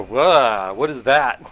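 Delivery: a loud mock gasp of surprise; startled whoa. Looking at the jigger, surprised by the coconut cream: startled whoa. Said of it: "I look at my jigger and go".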